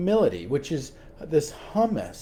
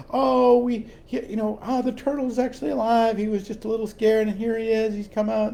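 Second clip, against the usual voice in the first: higher, quicker, delighted announcement; triumphant mom; 7 semitones higher than usual. Retold or imitated. Mom brings the live turtle to her daughter, triumphant and higher. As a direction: show off the live turtle proudly. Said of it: "kinda triumphantly comes into the room".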